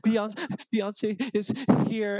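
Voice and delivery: high, slow, hammed-up tearful delivery; mock near tears. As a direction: choke it out as if crying. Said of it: "on the verge of tears".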